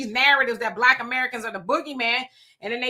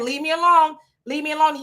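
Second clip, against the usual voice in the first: mild retold fearful plea, a little higher and louder; imitating scared pleading; 5 semitones higher, 3 dB louder than usual. Streamer retells a truck driver begging to be left alone, mildly fearful. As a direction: echo a frightened man backing away, lightly. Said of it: "you can hear the fear in his voice".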